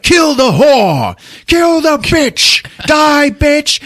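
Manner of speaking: high, loud, drawn-out screams; acted frenzied crowd yell; retold mob shouting. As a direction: bellow it slowly as a murderous mob. Retold mob attacking the woman.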